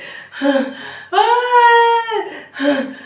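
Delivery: high drawn-out moan between pants; pant, then wail as if exhausted; panting groan. Acted. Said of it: "Tom was panting with exertion".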